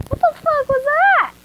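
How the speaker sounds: jolted by a sudden noise; startled shout. Real, not acted. Reader jumping at a noise in the room, shouting.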